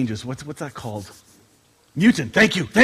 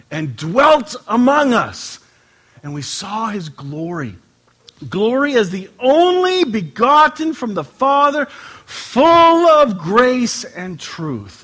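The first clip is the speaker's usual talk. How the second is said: louder, higher, slow at the end; scripture reading, swelling; 4 semitones higher, 4 dB louder, slower than usual. Acted. Pastor reading John, swelling solemn reading. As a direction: rise to the glory.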